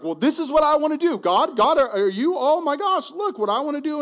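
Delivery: high, loud, fast excited gushing; an eager believer mocking their own excitement; giddy self-talk. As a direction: squeal like you just got your wish. Preacher voicing excited self-will.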